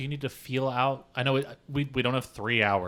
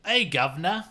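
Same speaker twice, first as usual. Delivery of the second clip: put-on British accent; 8 semitones higher than usual. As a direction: bellow like a Brit cheated of breakfast. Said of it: "I'm angry about breakfast".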